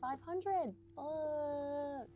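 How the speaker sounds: excited. Real, not acted. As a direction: yell it out when you hit the goal. Reaching target, exclaims.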